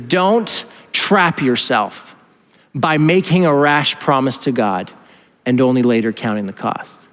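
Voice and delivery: slow, deliberate quoting; measured scripture reading. Acted. Preacher quotes Proverbs to church, measured reading. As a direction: read it out slowly, phrase by phrase. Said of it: "He says this, he says".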